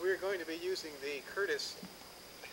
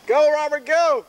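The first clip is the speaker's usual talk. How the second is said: loud, high cheer; cheering shout; 9 semitones higher, 17 dB louder, slower than usual. Real, not acted. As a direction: cheer him on loudly. Cheering climber from above.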